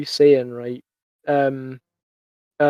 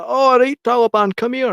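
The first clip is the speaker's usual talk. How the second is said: higher, hyped welcome; playful call; 8 semitones higher than usual. Retold or imitated. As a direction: call him over like a mate. Accepting nickname, playful.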